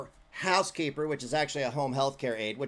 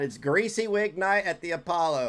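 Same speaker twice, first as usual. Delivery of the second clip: usual speaking voice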